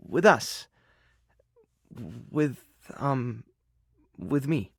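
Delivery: halting, with long gaps before each admission; shy confession. Acted. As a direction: quietly work up the nerve.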